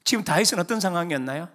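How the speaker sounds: normal talking voice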